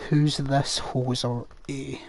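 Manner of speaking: slow, drawn-out Canadian hoser voice; a mock Canadian accent with a laugh in it. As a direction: lean into a hammed-up Canadian accent, chuckling. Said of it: "I apologize for my Canadian accent".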